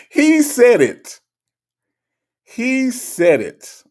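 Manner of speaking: high, loud, drawn-out insistence; slow, high, incredulous emphasis; emphatic insistence. Real, not acted. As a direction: stretch every word out loud and high. Insisting while cracking up.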